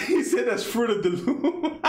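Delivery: real laugh at a chat joke. Real, not acted. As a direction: laugh through the line.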